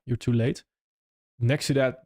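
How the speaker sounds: ordinary talk, nothing marked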